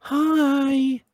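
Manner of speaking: put-on very high baby-talk hi; cutesy. Tara greeting the dino, very high coo. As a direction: coo at a tiny pet.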